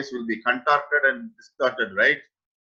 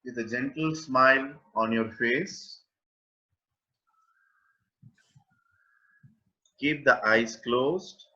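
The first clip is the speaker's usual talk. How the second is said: guided meditation. Acted. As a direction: guide listeners gently into awareness, with long pauses. (unhurried guided-meditation delivery, phrase by phrase)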